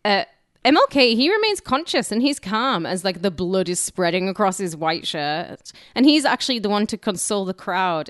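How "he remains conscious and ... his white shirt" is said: ordinary delivery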